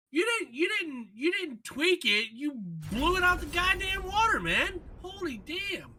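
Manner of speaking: high-pitched, disbelieving complaint; exasperated rant. Real, not acted. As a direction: rant up high, can't believe it. Ranting at Bungie over nerf.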